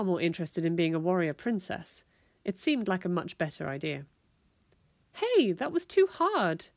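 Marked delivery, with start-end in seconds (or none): 5.1-6.7 high-pitched, drawn-out complaint; indignant storybook yelp; yelped protest. A narrator's reading. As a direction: yelp it like a hurt child. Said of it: "Walt yelped".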